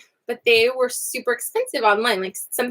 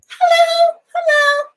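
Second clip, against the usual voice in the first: squeaky high puppet greeting; 5 dB louder, slower than usual. Pretend. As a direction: shout hello as a tiny puppet.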